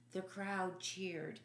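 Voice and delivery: usual speaking voice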